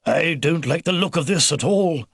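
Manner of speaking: usual speaking voice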